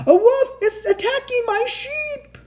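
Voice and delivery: high, loud frantic cry for help; pretend panic shout. A narrator's reading. Shepherd boy fooling the townspeople, panicked high shout. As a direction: shout it in a shrieking fake panic. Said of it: "pretended to be in a panic and shouted".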